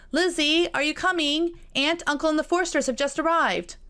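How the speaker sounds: high, loud call across the house. Acted. Jane to Elizabeth, calling her down to greet guests. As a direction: call her sister down to dinner. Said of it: "Jane said".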